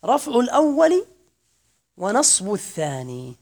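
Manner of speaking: teacher announcing the fifth case; stress on الأول. Shown as a demonstration. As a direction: spell out the rule slowly. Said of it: "الصورة الخامسة وهي الأخيرة".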